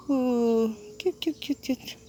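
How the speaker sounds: very soft, breathy cooing; hushed cooing. Real, not acted. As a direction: coo under your breath. Cooing at the bird.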